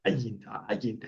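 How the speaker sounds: "Ayin, ayin" with a strong throaty onset, softly; guttural ayin. Shown as a demonstration. Teacher showing how Arabs say "Ayin, ayin", guttural. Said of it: "la pronuncian muy bien, muy fuerte".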